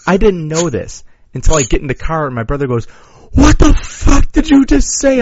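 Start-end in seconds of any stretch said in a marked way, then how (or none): 3.3-5.2 high, loud, drawn-out mock outrage; slow, shrieked confrontation, played for laughs; retold outraged shout. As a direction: imitate a scandalized brother yelling. Brother confronts him in the car.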